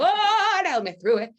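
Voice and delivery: high, loud desperate plea to God; mock panicked prayer. Driving through the ice storm, a hammed-up frantic prayer. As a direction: cry out to the heavens.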